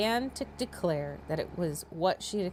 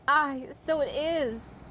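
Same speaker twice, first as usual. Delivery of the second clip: higher, slow, loud exclamation; mother crying out; 6 semitones higher, 3 dB louder, slower than usual. A narrator's reading. Mother to daughters about the ball, crying out slow and high. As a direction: cry it out, drawn and high. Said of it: "cried her mother".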